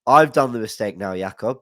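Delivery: ordinary talk, nothing marked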